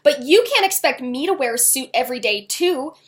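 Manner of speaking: loud, fast, heated. A narrator's reading. Girl yelling at her dad in front of the ranch, heated. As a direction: rush it out at full volume. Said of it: "as her voice grew louder".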